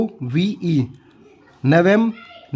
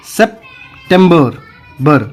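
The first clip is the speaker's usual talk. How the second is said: "September" slowly in English, sep... tem-ber; broken into syllables; 6 dB louder, much slower than usual. Shown as a demonstration. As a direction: split "September" after sep, with a pause. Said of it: "यहाँ पे कैसे बोलेंगे?".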